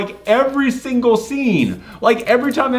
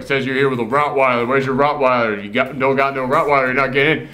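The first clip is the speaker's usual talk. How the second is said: lower, stern doorman voice; gruff bouncer; 7 semitones lower than usual. Retold or imitated. Bouncer turning Will Arnett away, lower and stern. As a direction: block him at the door, flatly.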